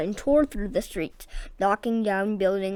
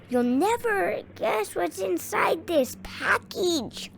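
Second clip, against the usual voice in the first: much higher, slow teasing call; Captain luring the cat; teasing shout; 10 semitones higher than usual. A narrator's reading. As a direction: lure the cat with a sing-song tease.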